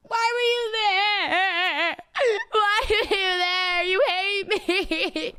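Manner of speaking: slow, high fake sob, drawing the words out; mock crying. Streamer fake-sobbing at fans who ignored her, high and slow. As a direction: whimper it like a hurt child, hamming it up.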